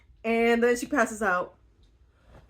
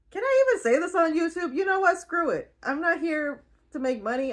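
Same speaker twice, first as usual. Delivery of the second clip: excited rant; 8 semitones higher, faster than usual. Real, not acted. Excited outburst about YouTube.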